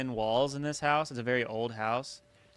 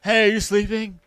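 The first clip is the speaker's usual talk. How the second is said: higher, louder teasing imitation; mock alarmed husband; 6 semitones higher, 7 dB louder than usual. Friend parodies the midnight wake-up, loud mock alarm. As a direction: play the panicked husband. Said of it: "retelling friend's story".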